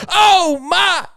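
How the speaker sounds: high, bright mock exclamation of wonder; mock surprised cry. Reading a fan's letter, imitating the clip's high cry. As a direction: squeal it like a soundboard clip. Dying man hears the clip.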